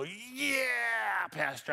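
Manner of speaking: a high, sarcastic yeah, pastor; mock eager agreement. Pastor mocking a congregant's eager agreement, sarcastic. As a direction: overeager yes-man cheer. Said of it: "don't want you taking that attitude".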